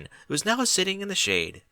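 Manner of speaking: plain reading voice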